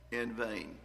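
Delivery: "in vain" slowly and deliberately weighted, the phrase put forward; stress on in vain. Shown as a demonstration. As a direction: lean on "in vain" as the key phrase. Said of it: "I want to emphasize that word".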